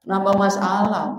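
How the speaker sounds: ordinary talk, nothing marked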